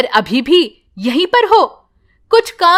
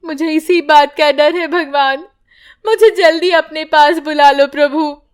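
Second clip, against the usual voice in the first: higher, tearful plea to God; weeping mother praying alone; crying prayer; 6 semitones higher, 3 dB louder than usual. Acted. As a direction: sob out the prayer. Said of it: "रोने लगती है".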